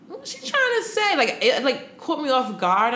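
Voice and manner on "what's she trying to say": much higher, baffled question; puzzled. Retold or imitated. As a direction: wonder aloud, caught off guard. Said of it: "I'm like".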